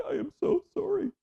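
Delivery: very high squeaky apologizing; mortified apology. Retold or imitated. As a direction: gasp out a flustered sorry. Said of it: "And she's like".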